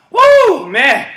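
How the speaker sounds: high, loud whoop; breathless whoop. Real, not acted. Coach after running the game: breathless loud whoop. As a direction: blow out after the run.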